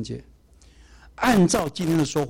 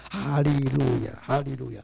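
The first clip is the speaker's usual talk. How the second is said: lightly imitated old sister, dragging out each hallelujah; slow hallelujah prayer; slower than usual. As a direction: mimic an elderly sister's slow prayer, drawn out. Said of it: "慢慢的念".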